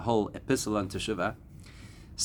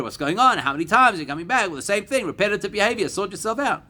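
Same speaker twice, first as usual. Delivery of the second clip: higher, louder mock exasperated scolding; irritated scolding; 9 semitones higher, 3 dB louder than usual. Teacher voicing a fed-up person scolding, exasperated. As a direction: scold someone who keeps repeating the same mistake. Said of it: "We get annoyed. We get irritated".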